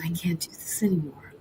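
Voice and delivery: whispered. Retold or imitated. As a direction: whisper it like you're breaking down. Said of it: "I started crying".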